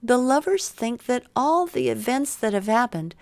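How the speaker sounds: ordinary delivery, nothing marked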